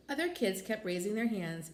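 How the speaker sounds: ordinary delivery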